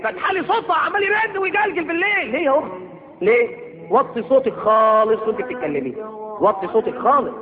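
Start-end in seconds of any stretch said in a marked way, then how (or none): none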